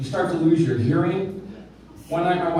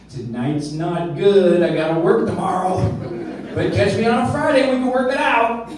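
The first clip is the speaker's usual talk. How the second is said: cheeky laughing reply; 3 semitones higher, 3 dB louder than usual. Retold or imitated. Driver cheekily brushes off the cop's jail threat, laughing. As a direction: tease the cop while laughing. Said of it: "I said".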